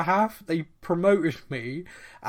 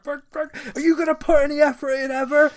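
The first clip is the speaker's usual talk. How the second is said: much higher and louder, fast, yelling across the training pitch; screaming at his mate; 11 semitones higher, 7 dB louder than usual. Acted. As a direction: bellow at a lazy teammate, fast and high. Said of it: "he's got to scream at his mate".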